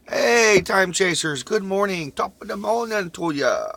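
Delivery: big, high, booming hello; loud excited greeting. Acted. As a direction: greet the fans like a morning DJ. Cheery morning show greeting.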